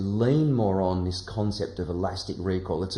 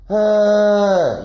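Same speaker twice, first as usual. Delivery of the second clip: a forced, tight "uh", much louder and higher; pushed tense sound; 10 semitones higher, 12 dB louder than usual. Shown as a demonstration. Teacher shows the wrong, pushed sound. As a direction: muscle the breath out hard. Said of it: "This is wrong".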